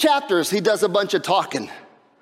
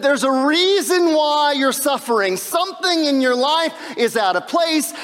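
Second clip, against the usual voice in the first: preachy know-it-all friends, raised and pushing; mocking the friends; 4 semitones higher than usual. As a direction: lecture Job like smug friends who are sure. Preacher voices Job's friends lecturing.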